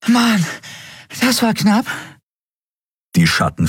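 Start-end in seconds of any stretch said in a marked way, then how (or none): 0.1-1.9 high, winded little creature; out of breath. A narrator's reading. Small Nelen after the narrow escape, out of breath. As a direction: gasp it out high after a big effort. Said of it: "rang nach Atem".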